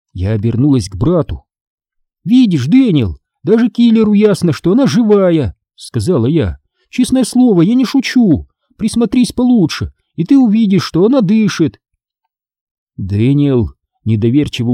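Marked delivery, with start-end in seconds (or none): 2.3-5.6 put-on high, keen child's voice; excited proof; eager insistence. A narrator's reading. Child insisting to doubting brother. 6.9-11.8 put-on very high, pleading child's voice; eager, earnest persuasion; earnest insistence. A narrator's reading.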